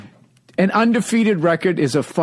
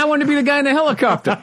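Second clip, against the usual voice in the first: excited; 13 semitones higher, much faster than usual. Real, not acted. Excited childhood dream.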